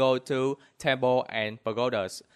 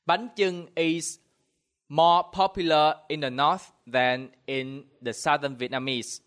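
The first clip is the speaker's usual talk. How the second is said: ordinary delivery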